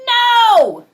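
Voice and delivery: high, loud drawn-out shout of no; big final no. Acted. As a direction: belt the final no like a tantrum. Climax of the no chant.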